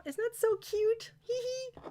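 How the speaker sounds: high squeaky coo with a giggle; gushing. Real, not acted. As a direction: squeal with delight at the cute doll. Gushing over the cute doll.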